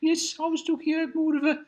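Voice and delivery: Birmingham accent. Shown as a demonstration. Showing the host a Birmingham accent, high-pitched Brummie accent. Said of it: "this is more of a Birmingham accent".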